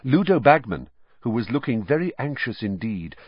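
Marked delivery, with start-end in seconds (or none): none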